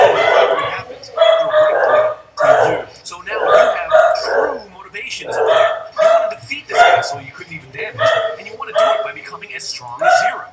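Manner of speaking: high, loud, laughing rant; hype-man shouting at the viewer; climactic shout. Real, not acted. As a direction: build to a triumphant yell. Climactic rant about motivation.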